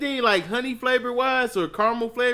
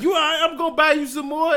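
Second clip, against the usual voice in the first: acted-out offer; 8 semitones higher, 4 dB louder, faster than usual. Voicing himself offering to replace it.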